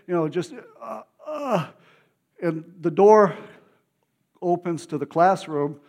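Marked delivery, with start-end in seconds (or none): 0.1-1.7 fighting back tears mid-story; choked up. Real, not acted. Preacher moved to tears telling the congregation, choked up. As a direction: voice catching on tears, pitch breaking upward. Overcome while recalling God's presence.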